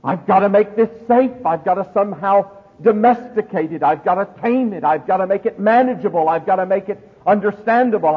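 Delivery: high, loud, frantic questioner; agitated wondering. Acted. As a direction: voice someone desperate to get it under control, nearly shouting. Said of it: "if you're wondering".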